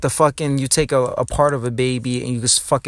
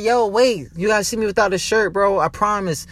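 higher, fast pleading to be believed; eager insisting; 6 semitones higher than usual. Retold or imitated. Telling a doubter to see him shirtless, eager fast insistence. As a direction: rush it out, begging them to look. I was like.

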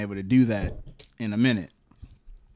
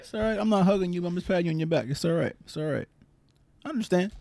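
higher, hushed mock soothing; mock comforting; 7 semitones higher, 3 dB quieter, faster than usual. Comforting his tearful co-host, playful hushed soothing. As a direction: pat her back with a soft, playful there-there.

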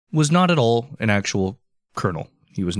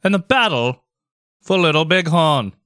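put-on raised-pitch old-timey showman; 5 semitones higher, slower than usual. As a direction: announce it like a carnival barker drawing in a crowd. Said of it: "leaning into the old-timey voice".

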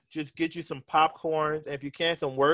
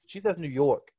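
New York vowels on "New York", lightly put on; New York accent. Shown as a demonstration. Host shows guest's New York accent for co-host. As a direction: say "New York" the way a real Brooklyn New Yorker would. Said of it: "She don't say New York".

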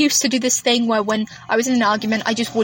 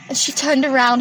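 ordinary delivery

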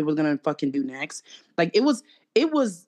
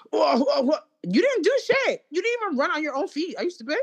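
mock outrage at Keke; 9 semitones higher than usual. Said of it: "Now you're like".

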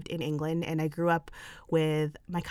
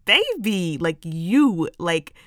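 emphatic hype; 6 dB louder, slower than usual. Real, not acted. Hyping up listeners emphatically.

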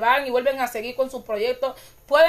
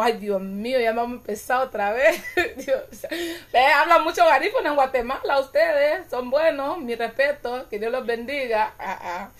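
ordinary delivery

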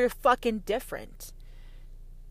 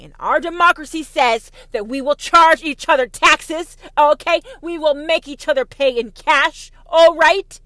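louder, higher, booming patriot proclaiming; mock patriotic shout; 4 semitones higher, 11 dB louder than usual. Patriot lecturing a commie, mock patriotic bellow. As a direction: bellow it like a flag-waving patriot.

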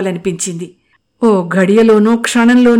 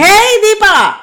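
much higher, much louder yell; louder shout; 13 semitones higher, 7 dB louder, much slower than usual. Acted. Anu yelling for Deepa again even louder, loud shout. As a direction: bellow up from below. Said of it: "మరింత గట్టిగా అరిచింది".

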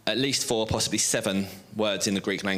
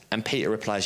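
ordinary talk, nothing marked

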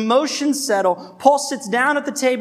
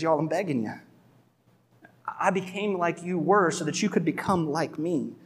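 quieter, gentle begging; soft plea; 6 dB quieter than usual. Retold or imitated. Paul seated with the Galatians, quietly begging. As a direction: plead softly, like a parent at the table.

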